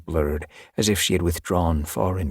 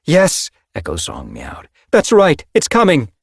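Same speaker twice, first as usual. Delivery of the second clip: louder, higher cheer as the kit comes; excited encouragement; 10 semitones higher, 8 dB louder than usual. Acted. Medicine cat cheering the queen on, louder and higher. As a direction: celebrate the birth, raised and bright.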